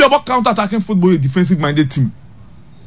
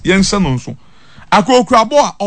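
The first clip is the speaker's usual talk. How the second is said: ordinary delivery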